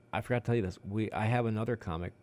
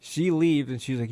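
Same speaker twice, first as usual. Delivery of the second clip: ordinary talk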